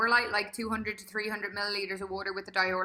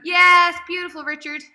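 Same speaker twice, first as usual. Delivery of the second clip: loud, higher-pitched whoop of praise; big, delighted coach's yes; encouraging cheer; 7 semitones higher, 15 dB louder than usual. Real, not acted. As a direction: cheer them on at full voice.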